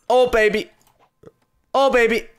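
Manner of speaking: high, loud gamer cheer; thrilled, punchy high exclamation; excited shout. Real, not acted. Excited reaction during gameplay.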